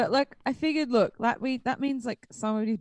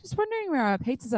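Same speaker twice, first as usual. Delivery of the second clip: higher-pitched, tentative phone enquiry, retold; lightly acted polite caller, voice lifted; casual polite query; 6 semitones higher than usual. Caller checking on a late pizza, casually polite.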